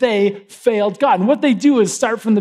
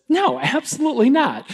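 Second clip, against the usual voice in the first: higher-pitched mock refusal; indignant frog refusal; 6 semitones higher than usual. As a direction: turn the scorpion down, pitch jumping up. Frog refuses scorpion's request.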